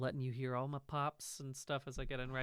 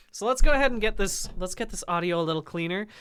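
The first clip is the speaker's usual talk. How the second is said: excited; 6 semitones higher, 12 dB louder than usual. Real, not acted. Excited fast setup, loud.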